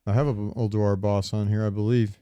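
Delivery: ordinary delivery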